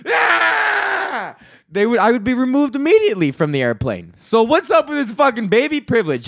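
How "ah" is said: very high, sudden scream; mock scream. Grown man screaming on the plane, high mock scream. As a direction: yelp out a long "Ah!". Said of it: "just went".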